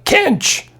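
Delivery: very high yell of the name; shrieking call. Acted. Buck Mulligan yelling for Stephen from the smoky fire. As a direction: shriek the name across the room.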